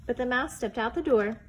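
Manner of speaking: ordinary delivery, nothing marked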